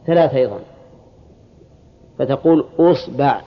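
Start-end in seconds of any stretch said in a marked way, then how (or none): 2.8-3.4 أصبع as "usba'", u first, a after ba; damma then fatha. Shown as a demonstration. Teacher starting the damma set, أصبع as "usba'". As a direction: say أصبع with opening u and second vowel a. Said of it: "ضم الهمزة".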